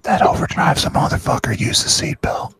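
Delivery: whispered sales pitch; ASMR whisper. Pretend. ASMR telemarketer pitch continued.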